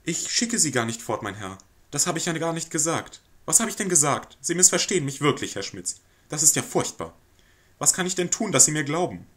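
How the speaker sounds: nervous society lady placating a guest; flustered lady of the house. Acted. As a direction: fluster and over-apologize to the lodger. Lady pleads with the lodger.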